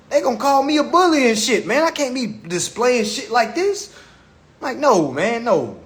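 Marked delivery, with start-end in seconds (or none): none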